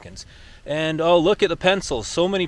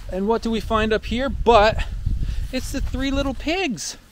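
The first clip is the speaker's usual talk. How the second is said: higher, building up to the reveal; excited reveal; 9 semitones higher than usual. Real, not acted. Revealing the three little pigs to viewers, excited. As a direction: tease then announce with glee.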